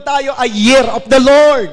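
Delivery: loud, slow proclamation; preached declaration. Real, not acted. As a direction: proclaim it with full force. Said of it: "Sabi mo". (malakas at mabagal na pagpapahayag)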